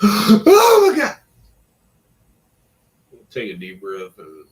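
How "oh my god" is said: startled gasp. Acted. Acting out being scared.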